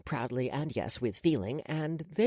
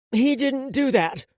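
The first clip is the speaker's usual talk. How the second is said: high, loud, slow protest; indignant aunt; 9 semitones higher, 6 dB louder, slower than usual. A narrator's reading. Aunt denies her nephew's insult, indignant. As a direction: defend the child, voice raised.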